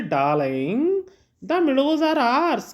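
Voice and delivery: high and drawn out on the meadows are ours, slow; the father tells Lomov the meadows are theirs, high and slow; Chubukov insisting. Acted. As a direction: stretch it high like a fussing father. Father Chubukov joins the quarrel.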